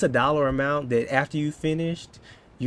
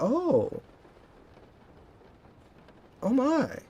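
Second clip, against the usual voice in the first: high, drawn-out impressed oh my; mock-impressed listener; impressed gasp; 10 semitones higher, much slower than usual. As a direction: play the awed onlooker, pitch way up. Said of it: "And they're like".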